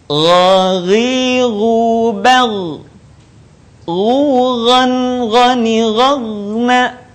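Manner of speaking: Ghaghiru chanted very slowly on held notes; thick Gha drill. Shown as a demonstration. Teacher models an example, slow sustained chanting of Ghaghiru. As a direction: thicken the gh and stretch Ghughan, ghani, ghagna. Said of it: "harus terdengar menebal suaranya".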